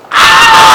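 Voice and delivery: very high scream into laughter; shriek. Retold or imitated. Imagining himself screaming off camera, shriek then laughter. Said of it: "you would have heard".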